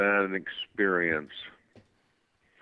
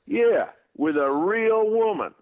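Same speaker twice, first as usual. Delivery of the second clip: slow, higher, louder proud brag; bragging fan; 14 semitones higher, 6 dB louder, slower than usual. Retold or imitated. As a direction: drag it out proudly like a smug young fan. Fan bragging in bar story.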